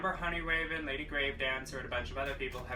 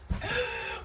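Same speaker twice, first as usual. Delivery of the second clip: mock gasp; 3 dB louder than usual. Reacting to the box.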